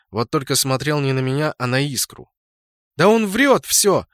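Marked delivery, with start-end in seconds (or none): 3.0-4.1 high, loud, drawn-out angry denial; angry protest. A narrator's reading. Accused clanmate justifies herself to leader, angrily. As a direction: defend yourself angrily, slow and high. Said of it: "со злостью оправдывалась хаоситка".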